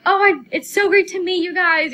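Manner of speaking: high, loud gushing fan; retold tearful fan. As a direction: imitate an overwhelmed fan meeting them. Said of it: "like, cries".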